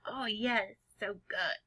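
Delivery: soft, hushed relish; savouring something yummy. Acted. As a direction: murmur it as if savouring a tasty bite. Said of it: "something really yummy and you're like".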